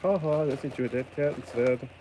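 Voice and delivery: lightly voiced gloating sender; mocking seller. Imagined seller gloating over the parcel, mocking voice. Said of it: "And we're like".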